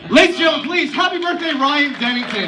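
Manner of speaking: high, loud crowd-rousing call; rally the whole room behind the birthday wish; loud announcing. Real, not acted. Fan rallies the audience for his brother's birthday, calling out loud and high.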